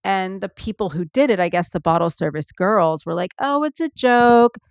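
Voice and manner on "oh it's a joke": mocking dismissive quote. Mocking the bottle service girls.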